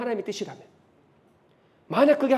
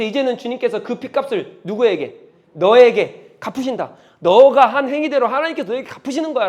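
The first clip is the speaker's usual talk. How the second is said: cursing; 3 semitones higher, 7 dB louder than usual. Acted. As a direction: pronounce doom on the king. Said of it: "저주를 막 퍼붓고 있는 거예요". (크게 저주를 퍼붓는 목소리)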